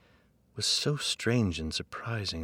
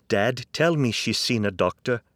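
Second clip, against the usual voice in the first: urgent plea; 5 semitones higher, 9 dB louder than usual. Acted. Son urgently pleads with dad.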